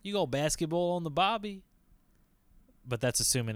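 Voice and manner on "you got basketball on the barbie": mock Australian accent. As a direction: toss off a cheesy Australian catchphrase. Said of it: "hey, Australian listeners out there".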